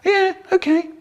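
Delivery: high, small-voiced little boy agreeing; put-on high kid voice; meek child. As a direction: squeak out obedient agreement like a child. Child meekly agrees to parents' order.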